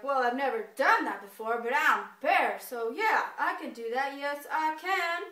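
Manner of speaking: loud, cocky bear voice; boastful bear. Acted. Bear accepting the squirrel's challenge, loud and cocky. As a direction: puff yourself up and declare it. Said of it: "Bear's like".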